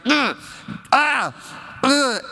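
put-on high, straining toddler grunts; imitated frustrated grunts. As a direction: grunt like a little kid forcing a toy in. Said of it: "she's getting so frustrated".

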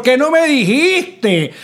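slow, high scolding whine; scolding friend. Retold or imitated. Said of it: "todo el mundo te regaña".

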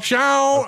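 "Sean" mimicked high and drawn out; mock his "Sean" back at him; imitating Sean. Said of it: "it's so great".

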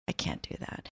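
soft unvoiced whisper. Deciding not to leave the movie, whispered. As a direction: barely breathe it out. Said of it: "but I said".

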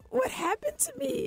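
high squeaky what-happened-to-me; mock bewildered squeak; self-quoted panic. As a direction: shoot way up in pitch, bewildered. Retelling inner panic, very high.

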